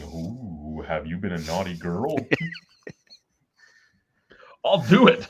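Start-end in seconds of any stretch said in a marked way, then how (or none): 0.0-2.1 low, soft mock sultry purr; hammed-up seductive tease; failed seductive. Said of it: "somebody's willing to pay for me going".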